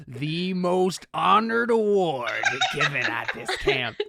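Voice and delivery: slow, solemn, ominous sentencing; menacing old man. Acted. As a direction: pronounce a disgrace with grave, stretched words. Ernest pronounces judgement on Paul.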